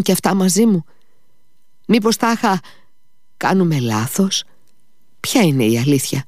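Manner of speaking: a guilt-ridden visitor pouring out his torment; desperate questions from a man crushed by guilt; anguished confessor. Acted.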